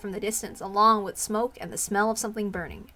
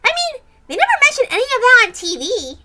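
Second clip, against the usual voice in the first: put-on higher, louder girl voice, incredulous; doubtful young girl; 13 semitones higher, 6 dB louder than usual. Girl doubting the disease exists, in a higher, louder young voice. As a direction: play a girl who can't believe it.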